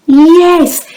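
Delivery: excited yes. Acted. Teacher cheering children's answer.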